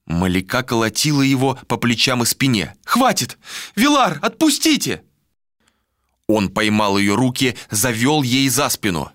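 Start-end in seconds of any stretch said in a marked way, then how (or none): 2.8-5.0 high, distressed cry for release; desperate protest. Acted. Malika beating at Vilar's shoulders, desperate protest. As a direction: plead urgently, far higher. Malika struggles in his grip.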